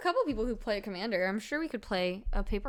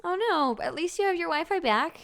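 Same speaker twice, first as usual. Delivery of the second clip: high, loud mock concern; mock sympathy; 9 semitones higher, 6 dB louder than usual. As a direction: fake sympathy, hammed up.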